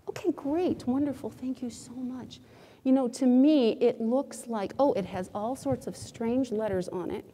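ordinary delivery

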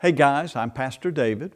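ordinary talk, nothing marked

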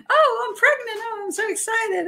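high, bubbly excited voice; high giddy announcement of pregnancy; gushing Angela. Retold or imitated. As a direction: play her thrilled and sugary. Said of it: "she's like".

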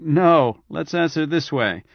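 ordinary delivery, nothing marked

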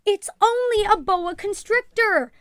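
calling out. A narrator's reading. Mother tries to settle the excited ladies, calling out. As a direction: call over a room of excited people. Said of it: "her mother called to the excited ladies".

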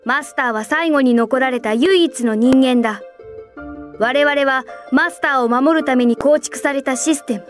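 firm, forceful admonishment; stern lecture. Acted. Superior reminding subordinates of their duty, stern. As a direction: drive the duty home firmly.

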